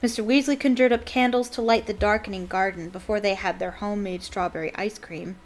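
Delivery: plain reading voice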